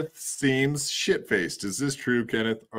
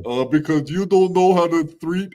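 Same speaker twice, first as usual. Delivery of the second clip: loud, high, hammed-up warning; Catalano TV; 5 semitones higher, 7 dB louder than usual. Reading a chatter's warning to Tony, loud hammed-up voice. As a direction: belt it out as an overwrought impersonation. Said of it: "Catalano T V".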